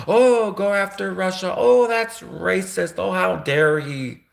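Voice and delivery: high, slow, sneering imitation of a scandalized pundit; mock outraged pundit. Mocking how critics would react to a Russian, high and sneering. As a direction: parody the pearl-clutching outrage, drawn out. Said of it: "people like Megyn Kelly would suddenly be going".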